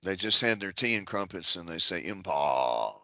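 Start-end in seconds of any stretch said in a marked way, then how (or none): 2.0-2.9 lower, drawn-out upper-class British voice; posh British drawl, pitched lower; mock posh British drawl. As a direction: lampoon an aristocrat's drawl on the word Empire. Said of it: "They have a drawl".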